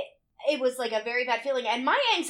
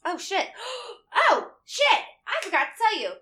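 higher, gasping alarmed oh shit; sudden realization; 11 semitones higher, 3 dB louder than usual. Retold or imitated. Remembering the forgotten announcement, gasping and alarmed. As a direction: catch yourself forgetting something big. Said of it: "And I was like".

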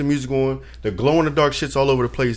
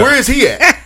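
high, louder, laughing through it; half-laughing, frantic search for the uncle; laughing disbelief, pitched up; laughing panic; 11 semitones higher, 6 dB louder than usual. Retold or imitated.

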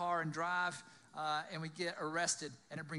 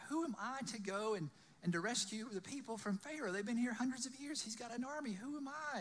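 mildly panicked, higher-pitched rambling; freaking out; 6 semitones higher than usual. Acted. Moses fretting about Pharaoh's army, acted mild panic. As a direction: pile up worries in an acted fluster. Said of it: "Moses is freaking out".

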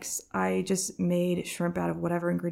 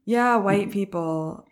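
normal talking voice

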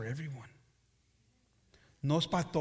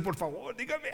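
softer imitation rising high into laughter; mock pleading; 5 semitones higher, 5 dB quieter than usual. Preacher mimicking what one would say, mock pleading. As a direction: playfully mimic someone begging to be told. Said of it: "A uno van a decir".

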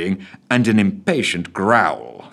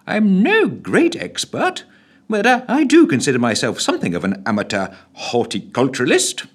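lightly voiced fussy official; secretary; 6 semitones higher than usual. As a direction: modest boast as the secretary. Secretary character voice.

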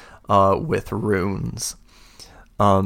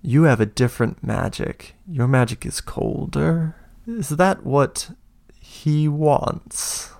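a touch of an uncanny prisoner, rising higher; eerie prisoner; 5 semitones higher than usual. Acted. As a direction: speak as if sensing the magic. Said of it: "she looks at you and she goes".

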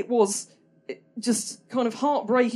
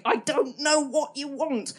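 slow, exasperated shout at a deaf cat; mock pleading; half-mock frustration; 6 semitones higher, slower than usual. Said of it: "just me opposite the cat going".

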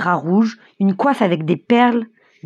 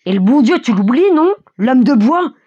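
raised voice, questioning reproach; old man shouting; 5 semitones higher, 5 dB louder than usual. A narrator's reading. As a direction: call out the reproach, higher and louder. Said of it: "crie un vieillard dans la foule". (vieillard qui crie, voix montante)

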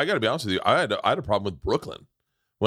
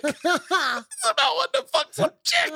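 very high, slow mock-sobbing squeal; mock crying. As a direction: wail it comically, as if blubbering. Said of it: "I start crying".

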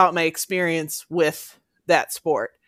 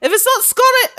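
high-pitched, louder try at a Scottish accent; Scottish accent attempt; 5 dB louder than usual. Shown as a demonstration. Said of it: "In a Scottish accent".

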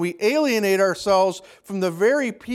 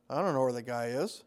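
much lower and softer, quick and dismissive; cynical brush-off; 8 semitones lower, 11 dB quieter, faster than usual. Retold or imitated. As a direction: toss it away, not caring. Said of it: "a very hard-hearted and a very cynical answer".

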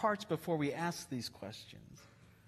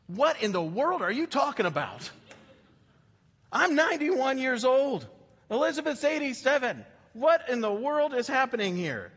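higher, louder disbelieving outburst; a startled old priest who can't believe the news; what in the world, I'm too old for this; astonished old Zechariah; 6 semitones higher, 3 dB louder than usual. Acted. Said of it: "I'm gonna give you my translation of this".

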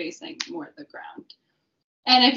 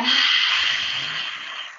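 lion's breath roar; 6 semitones higher than usual. Shown as a demonstration. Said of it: "Exhale, roar".

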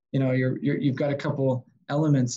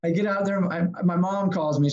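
normal talking voice

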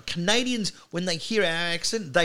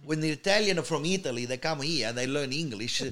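mock Italian-accented English; singsong put-on Italian accent; 2 semitones higher than usual. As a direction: imitate an Italian immigrant speaking English. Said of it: "the real Italian".

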